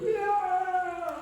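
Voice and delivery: long, high, loud wail; imitated baby wail. Father imitating his injured baby girl, loud high wail. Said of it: "she's wailing".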